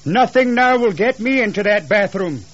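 high-pitched husband digging in; agitated husband calling upstairs; adamant refusal. Acted.

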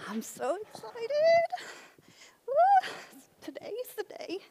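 high, gushing over-the-top excitement; giddy excitement. Acted. Skit believer gushing to Jesus, high and giddy. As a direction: squeal like you can't wait.

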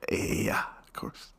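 hushed whispered Pep; mock whispered Pep Guardiola; Pep Guardiola impression. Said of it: "Pep Guardiola impression".

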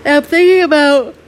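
talking through a yawn; words stretched by a yawn. Real, not acted. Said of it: "sorry, I'm yawning".

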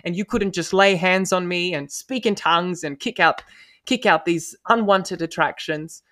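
loud, high frustrated yelling; angry outburst. Retold or imitated. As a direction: vent years of pent-up anger. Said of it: "I was really angry at that response".

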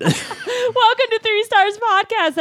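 put-on high, slow, sing-song girly greeting. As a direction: greet them like a sweet Rosie. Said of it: "You sound like your name is Rosie".

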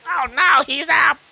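high, panicky mock yelp; scared hyenas. Hyenas panicking as the believer wakes up, mock fear. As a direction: squeal like a frightened hyena. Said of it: "they're scared".

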